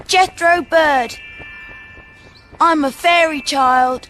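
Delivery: put-on high, slow tiny fairy boy's voice. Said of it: "she said".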